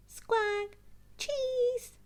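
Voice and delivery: high, loud squawking parrot; Polly parroting the shopping order, squawky. Acted. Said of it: "Polly repeats".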